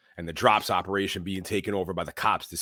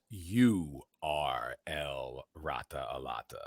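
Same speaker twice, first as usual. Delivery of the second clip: much lower, softer, very slow Batman growl; 7 semitones lower, 6 dB quieter, much slower than usual. Retold or imitated. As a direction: drop deep and slow like Batman. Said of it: "Batman goes".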